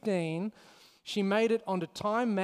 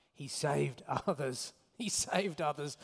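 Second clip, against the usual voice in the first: muttering; 4 semitones lower, 5 dB quieter than usual. Acted. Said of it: "they were muttering".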